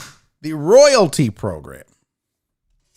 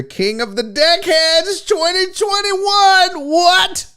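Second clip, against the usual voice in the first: high, stretched-out title announcement; hyped announcer shout; 11 semitones higher, slower than usual. Pretend. Host unveils the winner's trophy, hyped announcer shout. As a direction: build to a high triumphant shout.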